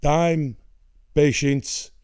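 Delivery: imitating an Italian father, deliberate pauses; accented, measured dad voice; old man impression. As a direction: count out life lessons like a philosopher dad. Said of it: "this is a very good impression".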